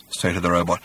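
ordinary delivery, nothing marked

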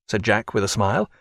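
ordinary delivery, nothing marked

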